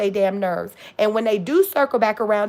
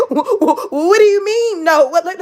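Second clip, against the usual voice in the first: high, loud mock stammer through laughter; hammed-up flustered stutter of disbelief; 7 semitones higher, 6 dB louder than usual. Mimicking people clutching pearls at a no, stuttering. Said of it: "They gonna goddamn start stuttering and shit".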